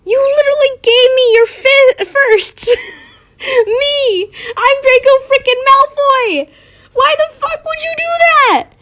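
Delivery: high-pitched, indignant acted shriek; furious outburst. Acted outburst of furious Draco, read aloud.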